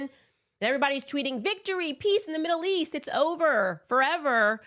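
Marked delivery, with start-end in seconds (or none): 1.4-4.6 high, gushing victory cry; mock cheer, pitched high; mock triumphant cheer. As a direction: ham up the celebration. Mocking celebratory tweets.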